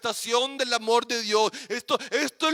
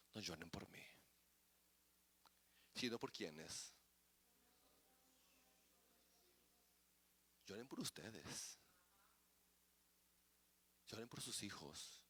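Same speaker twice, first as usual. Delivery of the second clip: hushed Jesus quote; 10 semitones lower, 30 dB quieter than usual. Acted. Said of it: "qué les dijo a ellas".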